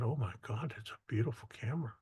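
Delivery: soft, fast, breathless awe; hushed awe. Retold or imitated. Young photographer gushing over his girlfriend's camera, hushed awe. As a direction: gush quietly over a camera.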